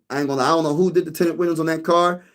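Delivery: normal talking voice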